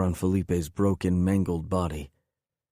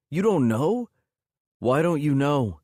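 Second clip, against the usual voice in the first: much higher and louder, DONT pushed hard; building anguish; 8 semitones higher, 4 dB louder than usual. Acted. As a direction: let the disbelief burst into a cry.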